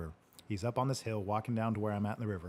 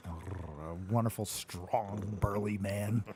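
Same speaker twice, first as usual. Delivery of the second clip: sincere praise; 3 semitones lower, 5 dB quieter, much slower than usual. Real, not acted.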